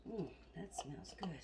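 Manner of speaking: whisper. Real, not acted. Savoring food while cooking.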